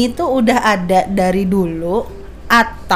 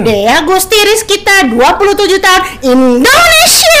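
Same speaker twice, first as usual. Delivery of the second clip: pageant announcer; 8 semitones higher, 5 dB louder than usual. Shown as a demonstration. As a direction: introduce yourself like a beauty queen. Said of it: "harus pakai nada".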